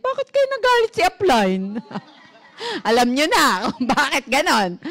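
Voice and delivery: high laughing tease; giggling while teasing the audience. Real, not acted. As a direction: laugh through the teasing question. Teasing the audience about upline.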